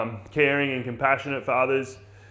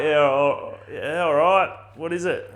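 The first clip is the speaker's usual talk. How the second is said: mock bloke voice; 2 semitones higher, 3 dB louder, slower than usual. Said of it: "he's like".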